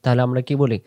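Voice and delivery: ordinary talk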